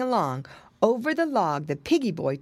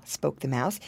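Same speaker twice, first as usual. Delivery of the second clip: ordinary delivery, nothing marked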